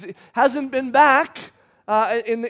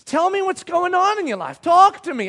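high, urgent mock plea; raised-pitch, imploring parent voice; pleading parent; 9 semitones higher, 5 dB louder than usual. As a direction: beg a teenager to open up.

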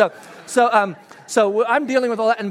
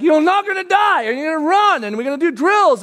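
raised, higher parental insistence; firm parent reply; 7 semitones higher, 6 dB louder than usual. Retold or imitated. Parent to reluctant kid: raised, insistent. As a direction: parent overruling a whining kid, pitched up. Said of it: "And so you're like".

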